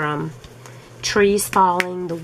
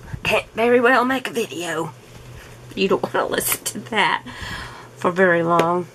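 ordinary talk, nothing marked

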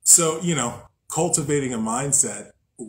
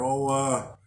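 normal talking voice

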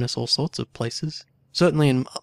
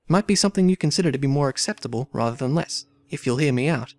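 dramatic story reading; 4 semitones higher than usual. A narrator's reading. Player reading game dialogue aloud, lively character reading. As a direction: voice the valet's protest, then the lady's demand.

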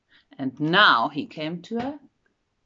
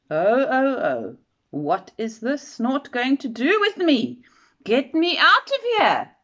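frightened; 7 semitones higher, 6 dB louder than usual. Acted. Bird carried off by the snort.

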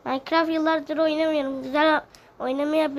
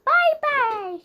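cheerful goodbye; 12 semitones higher, 5 dB louder than usual. Acted. Bouncy goodbye to viewers.

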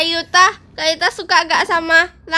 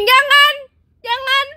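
high sharp no; panicked plea; 7 semitones higher, slower than usual. Pretend. Refusing to be dipped, high sharp cry. Begging not to be dunked.

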